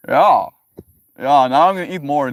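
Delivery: sarcastic reply. Acted.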